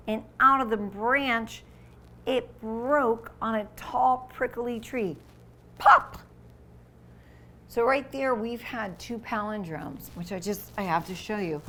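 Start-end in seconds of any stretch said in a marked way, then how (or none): 5.8-6.2 high, loud popping burst; loud pop. Acted. Reading to kids, loud balloon pop.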